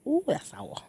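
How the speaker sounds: high soft wince at sourness; sour face reaction. Real, not acted. Tastes sour cherry.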